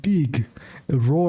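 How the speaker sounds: mock Russian accent in single words. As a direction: mimic the father's heavy Russian accent. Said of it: "his father's a heavy Russian accent".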